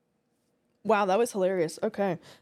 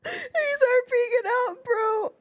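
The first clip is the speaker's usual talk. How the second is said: high, slow words wheezed out through tears of laughter; losing it completely, words stretched by laughing; crying with laughter; 14 semitones higher, slower than usual. Real, not acted. Laughing at a scene on stream.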